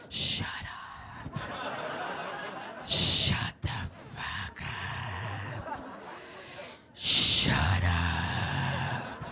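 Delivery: low, slow, unvoiced rasping shut up; homeless man growl. Homeless man growling at the chatty girls, low and slow. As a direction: rasp it out over their heads. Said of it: "homeless man ... went".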